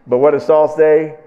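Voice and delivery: normal talking voice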